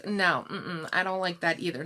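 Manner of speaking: normal talking voice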